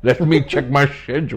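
mock classically trained English actor's accent. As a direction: put on a grand English thespian accent. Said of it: "speak with an English accent".

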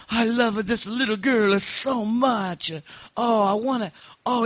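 high-pitched, gushing mock Sicilian accent; delighted Sicilian don. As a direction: swoon over the child like an old Sicilian don. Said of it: "he was so happy to hold".

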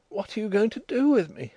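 put-on high, mildly whimpering girl; a plaintive question pitched well up. A narrator's reading. Nellie asking what becomes of her, lightly whimpered. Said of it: "she whimpered".

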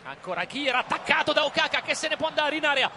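excited goal commentary; live play-by-play. Acted.

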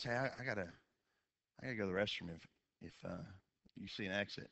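a hint of a half-asleep wife calling from the back; groggy wife asks husband for a restroom stop, softly; sleepy wife. Sleepy wife voiced from back seat.

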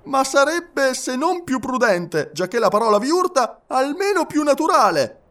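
high-pitched, earnest character voice; insistent Passepartout. A narrator's reading. Passepartout objecting to passengers who interrupt him, insistent.

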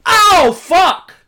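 high, loud shocked curse; mock yelp of pain. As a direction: yelp as if a yawn hurt. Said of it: "Like that".

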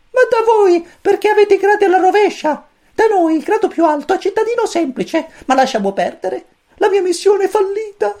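very high, squeaky little chick voice; cosmic chick voice. A narrator's reading. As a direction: chirp it like a tiny alien chick. Pulcino cosmico parla. (vocina acutissima da pulcino)

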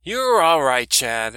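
put-on a loud old woman teasing about moping Barry; brash old woman. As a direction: rib the young man like a loud old auntie. Doris voiced by narrator.

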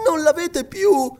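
put-on high, slow young voice; dismayed question. A narrator's reading. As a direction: ask in high-pitched disbelief. Girl dismayed document is gone.

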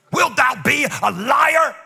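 high, slow, dramatic accusation; Jeremiah accusing God. Acted. Preacher voices the prophet calling God a liar, dramatic accusation. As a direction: hurl it at heaven, drawing out each word. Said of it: "He's calling God a liar".

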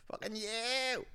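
high, drawn-out whoop; mock street call; catchphrase yell. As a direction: whoop it like the rowdy teacher. Acted teacher's signature yell.

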